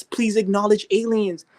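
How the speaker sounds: high, slow whiny plea; mock begging. Mocking believers begging the government, whiny plea. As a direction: beg like a pathetic whiner. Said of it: "sound like some bitches begging".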